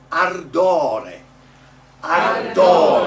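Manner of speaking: very loud "Ardore" with rolled r; teacher calling "Ardore" for the class to echo; model Italian word. Shown as a demonstration. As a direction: project "Ardore" with a long stressed o. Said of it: "next word".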